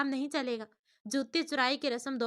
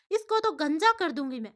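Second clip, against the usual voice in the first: raised, louder furious threat; angry threat; 4 semitones higher, 6 dB louder than usual. Acted. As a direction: snap out the threat, fuming. Friend threatens culprit in anger.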